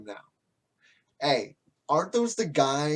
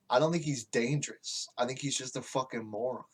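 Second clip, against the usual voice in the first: usual speaking voice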